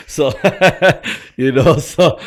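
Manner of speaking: laughing loud and high; breaking up laughing. Real, not acted. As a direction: laugh through the words. Laughing at own story.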